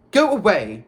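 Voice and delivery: loud, annoyed dismissal; irritated snap. A narrator's reading. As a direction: snap at someone to leave you alone. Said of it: "Percy snapped".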